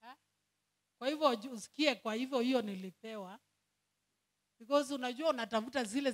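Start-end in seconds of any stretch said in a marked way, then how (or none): none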